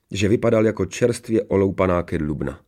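plain reading voice

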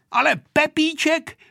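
put-on high-pitched childish cry; insistent shout. A narrator's reading. Vojta objecting about Pepíček to the pilot, insistent shout. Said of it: "křičel Vojta".

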